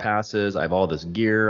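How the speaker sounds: normal talking voice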